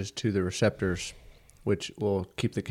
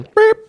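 alarm beep imitation; 13 dB louder than usual.

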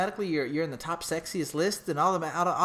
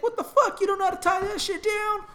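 high, louder disbelieving dad; mock shocked dad; 4 dB louder than usual. Retelling his dad's phone reaction, high mock shock. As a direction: squeal it like a stunned dad.

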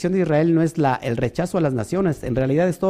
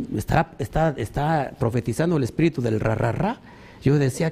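ordinary talk, nothing marked

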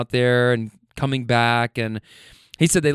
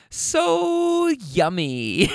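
high, very stretched-out tipsy imitation; drunk woman; 9 semitones higher, much slower than usual. Drunk girlfriend chiming in from the back, high tipsy squeal. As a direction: drag it out high like a tipsy passenger. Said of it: "with a drunk person".

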